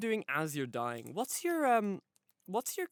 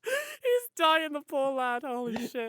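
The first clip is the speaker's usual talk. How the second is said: high, panicked, hammed-up; mock panic; 9 semitones higher, slower than usual. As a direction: fake alarm over a dying lad. Pretends friend is dying.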